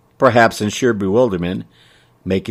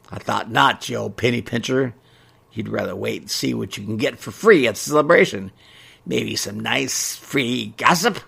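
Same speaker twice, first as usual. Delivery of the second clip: teasing shopkeeper. Acted. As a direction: needle her slyly. Ray teasing Mabel.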